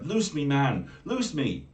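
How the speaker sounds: loud, high frightened plea; cried out in terror. Acted. Bound mermaid cries out to her captor in terror. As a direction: beg to be freed, scared. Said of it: "she cried out with terror".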